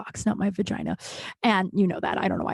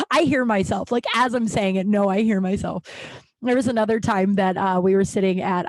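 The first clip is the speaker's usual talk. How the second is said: ordinary talk, nothing marked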